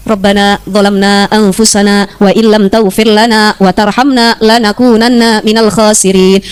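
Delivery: slow, low-pitched Arabic recitation; prayer recitation. Acted. (melafalkan doa perlahan, nada rendah)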